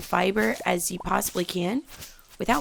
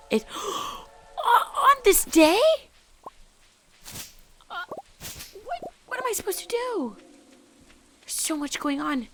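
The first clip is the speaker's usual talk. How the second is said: high gasping panic, rising pitch; flustered and overwhelmed; panicked; 13 semitones higher, 4 dB louder than usual. Real, not acted. Panics at moss-covered day.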